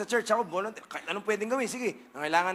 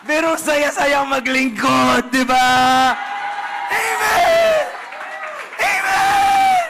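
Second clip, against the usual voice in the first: slow build to loud, high amen shouts; preaching at full force; 6 semitones higher, 12 dB louder, much slower than usual. Acted. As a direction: preach it up to the rafters. Preaching climax with amens. (malakas na pangangaral hanggang amen)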